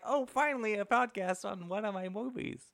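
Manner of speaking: mock delighted actor. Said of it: "Because, like".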